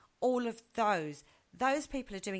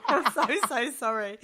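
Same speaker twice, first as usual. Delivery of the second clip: laughing apology; 5 semitones higher, 10 dB louder, slower than usual. Retold or imitated.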